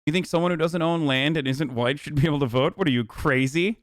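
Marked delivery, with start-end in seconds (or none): none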